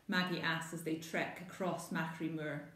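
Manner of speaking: plain reading voice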